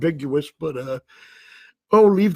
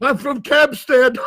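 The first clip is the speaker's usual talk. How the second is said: put-on slow, high, laughing accent; 8 semitones higher, slower than usual. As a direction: deliver the pun through laughter. Joke viewer voice with laugh.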